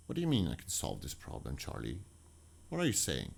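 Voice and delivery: a mother who can't believe her ears; lightly voiced shocked mom; stunned mother.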